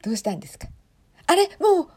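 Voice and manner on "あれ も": startled, loud and high outburst; real surprise. Real, not acted. Live viewer reacting to the mic being handed over, surprised.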